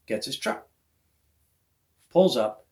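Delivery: normal talking voice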